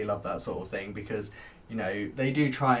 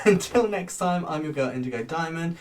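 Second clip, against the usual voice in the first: ordinary talk, nothing marked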